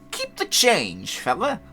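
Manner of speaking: high, loud, drawn-out cocky customer; put-on voice. Teen customer waving off fourteen cents, in a hammed-up put-on voice. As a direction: imitate a kid hamming up a big-shot line. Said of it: "put on, like, a voice".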